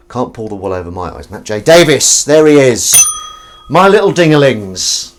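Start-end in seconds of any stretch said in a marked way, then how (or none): 1.3-5.1 loud, high announcing call; big, high, shouted greeting. Real, not acted. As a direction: hail them loudly across the room. Calling out to arriving viewers.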